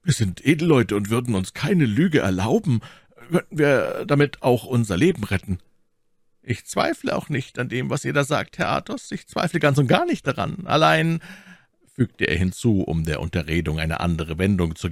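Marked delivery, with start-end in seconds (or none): 0.0-5.6 mildly proud, pitched a little up. A narrator's reading. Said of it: "sprach Athos stolz". 6.4-11.2 lightly voiced cardinal, higher pitch; placating cardinal. A narrator's reading.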